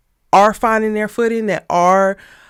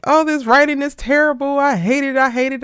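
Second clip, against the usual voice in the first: mock rant; 7 semitones higher, 4 dB louder than usual. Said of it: "with my breakdown".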